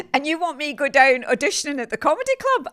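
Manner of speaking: fast, loud, laughing incredulity; laughing disbelief. Real, not acted. Scoffing to the host at auditioning in a comedy club, fast laughing. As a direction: rattle it off laughing at the idea.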